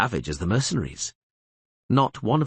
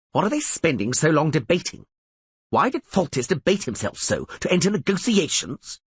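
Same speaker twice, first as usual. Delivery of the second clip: fuming complaint; 7 semitones higher than usual. A narrator's reading. Bronibor fuming at negotiations.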